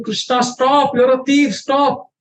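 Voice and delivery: acted high-pitched scolding, at normal volume; calling out a thief. Gopi tells Krishna to stop stealing, high-pitched calling out.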